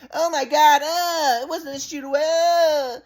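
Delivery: high, drawn-out fake wail; mock wailing mom. Son mimicking mom's denial, high mock wailing. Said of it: "my mom's like".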